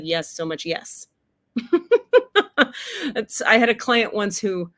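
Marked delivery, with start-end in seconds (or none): 1.6-3.1 sudden high-pitched laugh; high giggling laugh that climbs. Real, not acted. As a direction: let a bright laugh burst out, rising. Bursts out laughing after yes.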